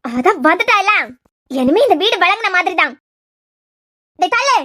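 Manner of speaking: loud, bitter complaint ending in a shove-off order; angry grumbling. Acted. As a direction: spit it out with resentment. Mother angry at daughter-in-law arriving.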